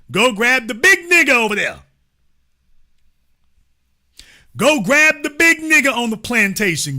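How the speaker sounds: imitating an urgent, commanding mob leader; acted lynch-mob order. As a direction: call it out like a ringleader giving orders.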